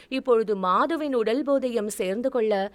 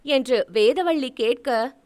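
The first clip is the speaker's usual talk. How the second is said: plain reading voice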